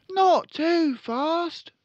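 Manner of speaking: put-on high, loud little boy; young son voice. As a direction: squeak it like an eager son.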